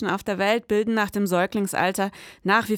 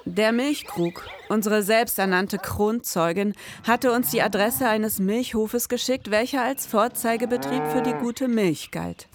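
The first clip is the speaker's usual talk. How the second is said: low, measured crime-novel voiceover; brooding storyteller reading; noir story narration; 3 semitones lower than usual. A narrator's reading. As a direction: set the scene like a detective story.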